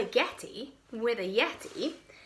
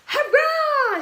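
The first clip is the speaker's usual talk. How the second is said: very loud, high cheer; boy sure he has found the Yeti; excited shout; 13 semitones higher, 9 dB louder than usual. Acted. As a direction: cheer at the top of his voice. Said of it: "shouted George".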